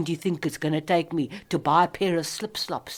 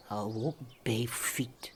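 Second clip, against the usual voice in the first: whispered to herself; 4 semitones lower, 10 dB quieter than usual. Real, not acted. Woman whispers her resolve to herself. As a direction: breathe it under your breath.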